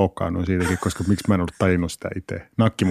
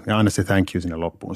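usual speaking voice

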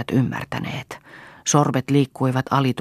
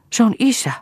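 very high, startled exclamation; scared voice, pitch leaping up; frightened; 11 semitones higher, 4 dB louder than usual. A narrator's reading. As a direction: blurt it out in alarm. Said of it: "sanoi Hanna pelästyneenä".

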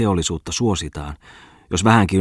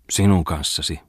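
ordinary delivery, nothing marked

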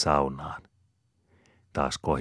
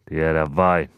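louder, short grunted reply; 8 dB louder than usual. A narrator's reading. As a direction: grunt it out gruffly. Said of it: "murahtaa Malakias".

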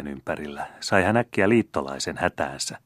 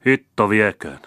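ashamed and roguish; 4 semitones higher, 7 dB louder than usual. A narrator's reading. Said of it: "sanoi skoonelainen häpeissä ja veitikkamaisesti".

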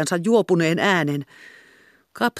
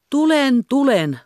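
high, louder reply through the door; calling back; 10 semitones higher, 5 dB louder, slower than usual. A narrator's reading. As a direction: answer the callers loudly. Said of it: "vastasi Magda".

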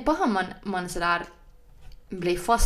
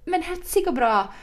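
high, upbeat colleague on the phone; 5 semitones higher than usual. Retold or imitated. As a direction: imitate a colleague's overly nice work greeting. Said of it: "hon har P R-rösten".

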